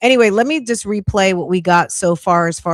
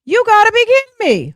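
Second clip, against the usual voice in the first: much higher and louder squeal of disbelief; second take blows up into a shriek; 14 semitones higher, 6 dB louder, faster than usual. Retold or imitated. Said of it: "I was like".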